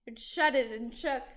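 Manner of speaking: ordinary delivery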